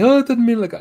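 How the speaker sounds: high, loud mock backpedal; hammed-up falsetto of a flustered man; flustered mock squeak. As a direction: squeak it out like a panicked apology.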